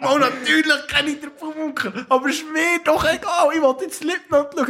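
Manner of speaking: exasperated retort. Retold or imitated. Retold own annoyed reply.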